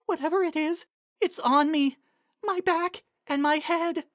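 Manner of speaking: high, slowed, frightened voice; pony feeling something on her back, scared. Acted. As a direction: barely dare to speak. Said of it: "very quiet, frightened voice".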